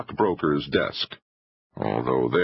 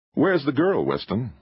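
demanding; 9 semitones higher than usual. A narrator's reading. Filmmaker presses the agent.

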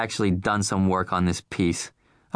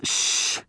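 sharp unvoiced shush; urgent shh. A narrator's reading. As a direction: hush her quickly. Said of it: "said Irv".

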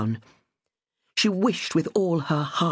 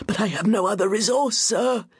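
a mild hint of panic, pleading upward; desperate plea; 7 semitones higher than usual. A narrator's reading. Winterbourne begs the stranger about his debts, higher and desperate. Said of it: "Winterbourne sounded desperate".

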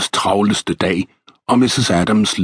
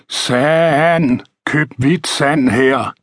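higher, drawn-out sand seller's call; sing-out hawker's cry, pitched up; street vendor's cry; 6 semitones higher, much slower than usual. A narrator's reading. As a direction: call your wares along the street, slowly. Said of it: "da han hørte råbet".

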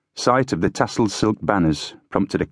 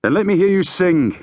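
high, raised-voice demand; high rallying demand; 12 semitones higher, 4 dB louder than usual. A narrator's reading. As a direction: call on the men to respond. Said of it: "Fitzgerald demanded".

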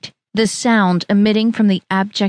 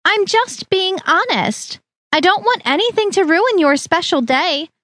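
Harriet's voice; 8 semitones higher than usual. A narrator's reading. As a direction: claim honesty in a high, syrupy tone.

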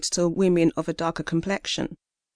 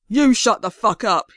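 slurred baritone shouting back, louder; drunken shout; 3 semitones higher, 4 dB louder than usual. Acted. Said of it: "he shouted".

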